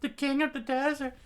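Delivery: very high falsetto fan frenzy; townspeople screaming. Acted. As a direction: shriek like swooning fans, hammed up. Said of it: "as the townspeople screamed out".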